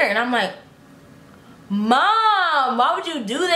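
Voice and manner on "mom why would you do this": higher, drawn-out complaint; embarrassed protest. Retold or imitated. Daughter complaining to mom about exposing her crush, embarrassed protest. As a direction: stretch "Mom" into an exasperated whine.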